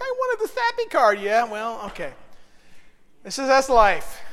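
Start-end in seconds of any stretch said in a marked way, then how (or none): 0.0-1.2 much higher whiny complaint; whiny wife. Retold or imitated. Pastor voicing his wife wanting the sappy card, high whine. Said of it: "She's like".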